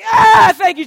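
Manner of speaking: loud, high yelp of stinging pain; hammed-up agonized shout; acted pain yell. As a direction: scream it out as if alcohol hit a cut. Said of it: "I was like".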